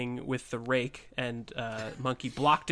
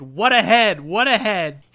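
loud, higher, frightened cry; frightened exclaim; 7 semitones higher, 11 dB louder than usual. A narrator's reading. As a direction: exclaim it in terror, shaking. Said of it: "as he exclaimed".

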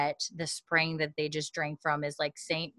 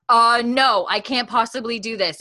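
higher, louder flustered no; alarmed refusal; 6 semitones higher, 7 dB louder than usual. Retold or imitated. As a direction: recoil at the very idea. Refusing the marriage idea.

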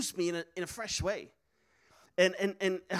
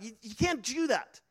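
ordinary talk

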